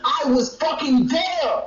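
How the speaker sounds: "I was fucking there" drawn out slowly; emphatic insistence. Real, not acted. Heated quarrel, insisting he was present.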